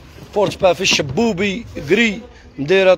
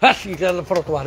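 ordinary talk, nothing marked

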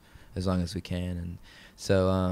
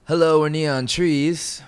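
announcing to camera; 8 semitones higher, 8 dB louder, slower than usual. Shown as a demonstration. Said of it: "do you guys wanna try that line".

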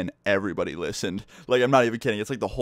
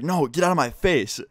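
higher-pitched firm rebuff; angry refusal; 6 semitones higher than usual. Retold or imitated. Teacher to student crowding in on the phone, angry refusal. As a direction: act out a teacher pushing back.

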